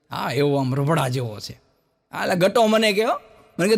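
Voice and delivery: ordinary talk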